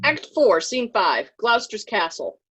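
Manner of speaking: ordinary talk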